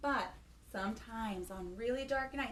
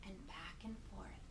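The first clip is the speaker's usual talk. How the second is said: hushed whisper, rocking rhythm; 6 semitones lower, 11 dB quieter than usual. Acted. Son rocking his old mother, whispered softly.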